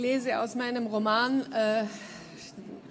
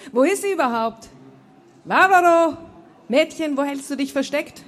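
laut und hoch nach jemandem rufen; übermütig über den Hof rufen, den Namen gedehnt; ausgelassenes Rufen; 5 semitones higher, 9 dB louder than usual. A narrator's reading. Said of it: "rief er und lachte wieder". (lautes, fröhliches Suchrufen)

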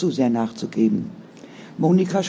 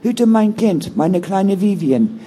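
ordinary delivery, nothing marked

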